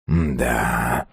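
low, soft, drawn-out "да"; musing. A narrator's reading. (задумчиво, низко и тихо)

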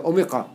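"أوميقا" said "omiqa", with a q; qaf spelling pronounced. Shown as a demonstration. Teacher reading the spelling used abroad, "أوميقا" with q. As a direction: read "أوميقا" with a back qaf sound. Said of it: "بره يكتب".

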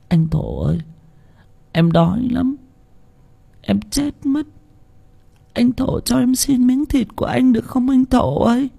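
put-on high, soft, weak little girl pleading; whisper-weak plea of a starving child; faint starving girl. A narrator's reading. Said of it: "thều thào nói". (giọng bé gái thều thào, yếu ớt)

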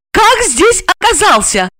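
high, accusing female voice; raging interrogation; furious demand. A narrator's reading. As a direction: hurl the accusation, pitched high. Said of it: "яростно требовала она".

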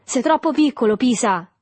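high, loud taunt, dropping on the name; mocking. A narrator's reading. Boy taunting his friend left outside, mocking. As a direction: jeer at the younger boy. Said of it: "disse il Tortima beffardo".

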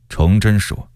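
plain reading voice

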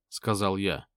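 ordinary delivery, nothing marked

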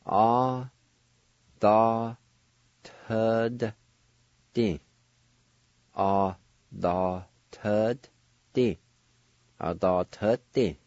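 "A, da, tud, di" said one slow syllable at a time, then repeated; teacher spelling out "A, da, tud, di" one clear syllable at a time. Shown as a demonstration. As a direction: sound out "A, da, tud, di" syllable by syllable with a pause after each. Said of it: "It's pronounced like this".

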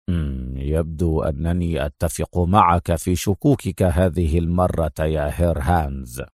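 low, stern general's voice. A narrator's reading. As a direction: drop low and speak sternly. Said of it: "ثم قال في صرامة". (بصرامة وبصوت منخفض)

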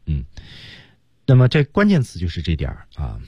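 ordinary talk, nothing marked

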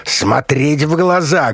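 high, drawn-out commanding yell; loud yell. A narrator's reading. Colonel interrogating the doctor, yelling. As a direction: order him at a high pitch. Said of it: "громко заорал Решетников".

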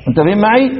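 ordinary delivery

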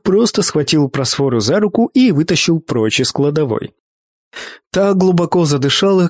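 usual speaking voice

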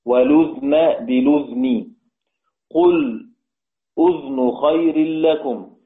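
أذن read as "udhn", the ذ silent and unvowelled, recited slowly; slow recital, every أذن said "udhn" with no vowel after the ذ; slow Quranic recitation keeping the ذ in أذن silent; reading with sukun. Shown as a demonstration. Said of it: "يعني يقرأ".

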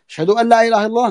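muezzin's call. Retold or imitated. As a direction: lightly sing it out like the call to prayer. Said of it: "قال".

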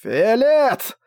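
high, drawn-out shout of a name; calling out. Acted. Regul to his attacking brother, high shouted call. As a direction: holler the name in sudden alarm.

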